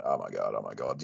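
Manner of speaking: low dazed muttering. Retold or imitated. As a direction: replay it to yourself, low and quiet. Replaying the miss in his head.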